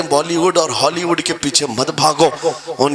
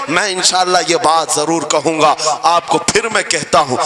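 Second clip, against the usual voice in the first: usual speaking voice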